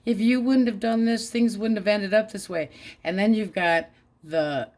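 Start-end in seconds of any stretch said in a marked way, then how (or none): 0.1-2.7 higher, louder mock blame; mock gaslighting. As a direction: act out a guilt-tripping ex, hammed up. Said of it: "emotion gaslighting".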